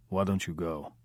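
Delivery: put-on quiet guttural Russian accent; lightly voiced Russian gangster, guttural; Russian loan shark brushing off the question in a guttural Russian accent. A narrator's reading. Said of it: "the tone quiet, guttural".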